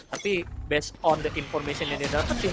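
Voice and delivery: ordinary talk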